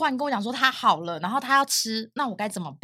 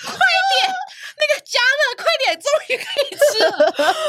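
high, louder eager shouting; imitating eager friends; 6 dB louder, slower than usual. Friends at the bar yelling to heat the porridge, high eager shouting. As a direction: bark out the hurry like starving partygoers. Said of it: "全部一群老辈就说".

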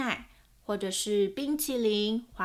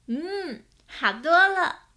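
much higher, loud delighted sniff-and-approve; pleased child; 8 semitones higher, 8 dB louder, slower than usual. Acted. Owen sniffing the dug-up blanket, high and delighted. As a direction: sound thrilled it smells better.

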